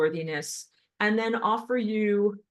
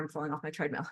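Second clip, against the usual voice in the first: ordinary talk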